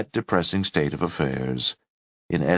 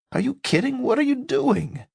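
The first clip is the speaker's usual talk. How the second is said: high, startled disbelief; alarmed and incredulous, pitched way up; shocked. A narrator's reading. Narrator shocked at joint.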